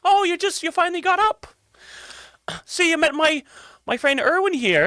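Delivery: high-pitched, faintly judgy sweetness; Mary's judgy tone. Acted. Mary introducing her friend to late risers, high and judgy. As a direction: pitch it high and needle them politely. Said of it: "hear that tone in her voice".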